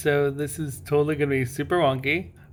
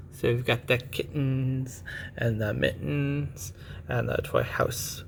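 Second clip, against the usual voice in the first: ordinary delivery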